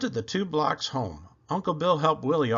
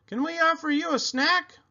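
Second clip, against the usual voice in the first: Uncle Bill's voice; 14 semitones higher than usual. A narrator's reading. Uncle Bill voiced, child's story.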